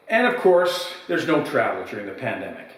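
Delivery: normal talking voice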